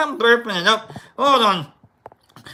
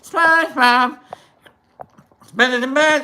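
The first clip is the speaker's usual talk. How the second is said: SpongeBob voice; 4 semitones higher than usual. Shown as a demonstration. Streamer answering chat's voice guesses, in a high SpongeBob voice. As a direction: squeak the name out like SpongeBob. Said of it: "you sound like, uh, Mister Krabs".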